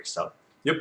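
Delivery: ordinary delivery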